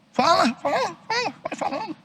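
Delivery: sing-song taunt at the talker; mocking high voice. Pastor taunts a parent who judges others, high voice.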